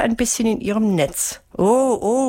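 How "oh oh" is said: lightly raised, higher exclamation; a mild cry of alarm; calling out. A narrator's reading. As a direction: call it out, higher but not shouted. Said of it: "rief Melissa".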